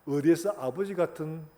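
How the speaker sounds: ordinary talk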